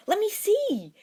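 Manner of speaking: high, loud eager cry; bursting to see the ring; excited. A narrator's reading. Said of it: "Harry said excitedly".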